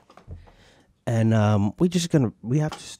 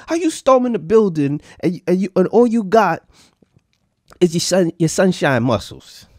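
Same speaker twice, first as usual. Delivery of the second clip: loud, high, incredulous roast; mock outrage; 8 semitones higher, 7 dB louder than usual. Real, not acted. Host roasting the rioter on video, loud mock outrage. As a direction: call her out, half laughing. Mocking the rioter, laughing hard.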